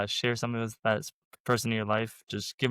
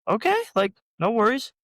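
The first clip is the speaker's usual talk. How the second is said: high, loud retold reply; acted breezy reassurance; 11 semitones higher, 5 dB louder than usual. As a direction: pitch it way up, imitating an easygoing reply. Acting own reply to customer.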